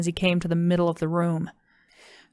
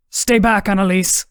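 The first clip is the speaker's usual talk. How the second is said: father crying out; 3 semitones higher, 7 dB louder than usual. Acted. Father shouting at his wife to keep back. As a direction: shout a warning over the shoulder. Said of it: "her father cried out".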